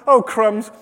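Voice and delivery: loud, high, rushed fretting; flustered panic. Acted. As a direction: panic aloud about feeding a guest. Voicing Martha's panic over food.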